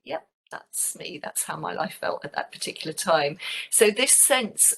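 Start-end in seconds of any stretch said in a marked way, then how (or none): 0.0-1.2 a slow, breathy near-whisper of agreement; resigned admission. Retold or imitated. Answering the man's remark about always running, a slow hushed yep. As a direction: concede it softly, barely voicing it. Teacher's wry reply to the man.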